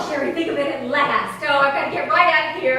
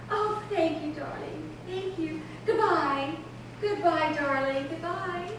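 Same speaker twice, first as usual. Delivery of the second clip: softer, cooing farewells; tender goodbye; 9 dB quieter, slower than usual. Acted. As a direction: let the goodbyes melt away softly.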